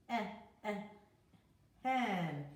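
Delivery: eh, eh, then hen; short e sound. Shown as a demonstration. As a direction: model the eh before hen. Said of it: "E sound".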